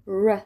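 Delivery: "ر" as an untrilled English r. Shown as a demonstration. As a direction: say "ر" as English r, tongue pulled back. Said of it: "از اینجا میاد". («ر» انگلیسی، بدون لرزش زبان)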